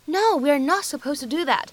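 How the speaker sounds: ordinary talk, nothing marked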